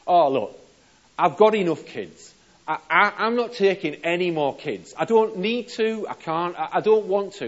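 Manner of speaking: high and loud, throwing the words out; exasperated loud refusal. Acted. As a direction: refuse outright, fed up. Chambers refuses more kids.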